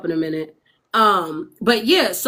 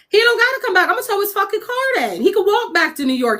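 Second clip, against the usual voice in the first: higher, exasperated rant; fed-up cop; 10 semitones higher, faster than usual. Retold or imitated. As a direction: rant like a cop who's had enough. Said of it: "So he was like".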